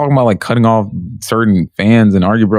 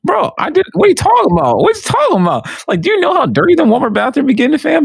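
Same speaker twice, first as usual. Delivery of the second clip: much higher, protesting; bro, you have no idea; indignant protest; 13 semitones higher than usual. Real, not acted. Defending bathroom job, indignant.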